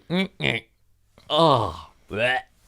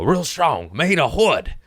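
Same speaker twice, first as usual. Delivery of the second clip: "Real strong. Made of wood." punched, a little higher; soundbite delivery; 6 semitones higher than usual. Shown as a demonstration. Making a soundbite for his own show, punchy. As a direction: sell it like a show jingle. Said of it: "soundbite of me for my own show".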